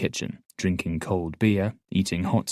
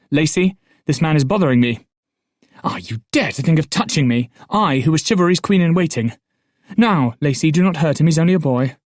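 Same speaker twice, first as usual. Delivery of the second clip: defiant scolding; 5 semitones higher, 7 dB louder than usual. Acted. Patience defies the guard, loud.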